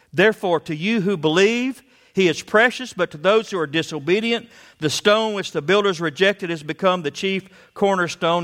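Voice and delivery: high, loud fervent preaching; preaching at full force. Acted. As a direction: thunder it from the pulpit.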